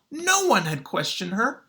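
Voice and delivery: ordinary talk, nothing marked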